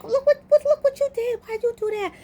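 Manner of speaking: high-pitched mock yelling; retold screaming. Onlookers yelling at dreamer after cousin falls, mock screaming. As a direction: imitate a panicked crowd in a high squeal. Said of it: "everybody's screaming, yelling, like".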